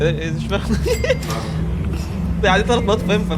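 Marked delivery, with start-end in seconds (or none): 0.8-2.4 airy high laugh; breathy laugh. Real, not acted. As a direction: laugh under your breath. Laughs at confused girl.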